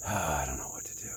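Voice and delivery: breathy whispered sigh of indecision; frustrated whisper. Real, not acted. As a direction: whisper it to yourself, stuck on a choice. Torn over which trail.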